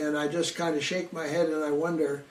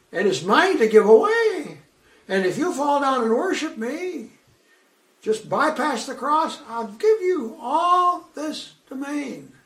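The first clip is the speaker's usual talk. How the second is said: high mocking tempter voice, slowing at the end; Satan tempting; 9 semitones higher, 5 dB louder than usual. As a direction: dangle the offer, sneering. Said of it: "But Satan here is saying".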